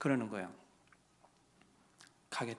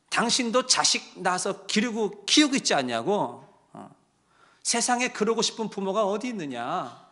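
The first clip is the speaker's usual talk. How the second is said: rising, louder and higher scolding plea; pouring it out; 5 semitones higher, 5 dB louder than usual. Retold or imitated. Pastor scolding the estranged daughter on the phone, rising heat. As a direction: let the rebuke build and pour out. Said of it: "저도 막 퍼붓었어요".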